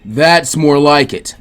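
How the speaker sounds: slow, loud, menacing lecture; stern mentor. Acted. Jiraiya lecturing beaten Naruto, slow and menacing. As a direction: press each word on him, slow and hard.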